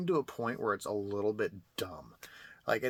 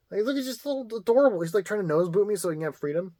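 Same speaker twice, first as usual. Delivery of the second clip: high, squealing cooing at a cat; 8 semitones higher, 7 dB louder than usual. Real, not acted. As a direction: gush over a fluffy cat, much higher. Talking baby talk to cat.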